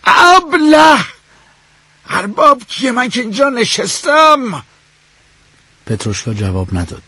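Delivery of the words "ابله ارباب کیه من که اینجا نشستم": high, indignant shout, slowing at the end; furious shout. A narrator's reading. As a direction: blow up at the insolent servant. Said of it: "داد زد".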